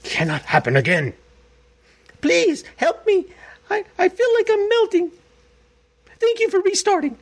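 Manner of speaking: high, melting whimper; whiny parody voice. Retold or imitated. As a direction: whimper like a wilting snowflake. Host parodies the legislator's words.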